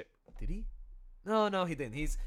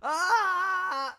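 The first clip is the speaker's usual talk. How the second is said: a higher mock yell; imitated scream; 14 semitones higher, 6 dB louder than usual. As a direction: let out a short scream. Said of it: "four variations of his scream".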